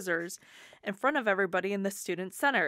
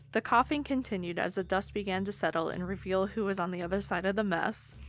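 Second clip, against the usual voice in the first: usual speaking voice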